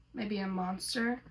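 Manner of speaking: ordinary delivery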